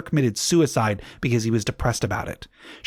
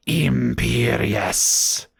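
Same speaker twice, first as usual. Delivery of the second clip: very drawn-out spell casting; Voldemort; much slower than usual. A narrator's reading. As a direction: stretch the incantation out menacingly, without volume. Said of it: "but then Voldemort shouted".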